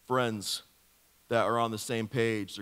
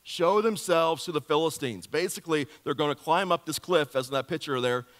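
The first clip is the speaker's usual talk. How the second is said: ordinary talk, nothing marked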